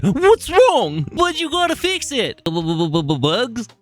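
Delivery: high cartoon voice, stuttering at the end; Looney Tunes cartoon voice. Mocking the game dialogue as a Looney Tunes cartoon, high squeaky voice. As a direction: ham it up like a nineties cartoon. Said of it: "that's how they sounded".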